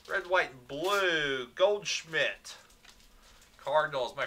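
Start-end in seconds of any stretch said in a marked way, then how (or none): none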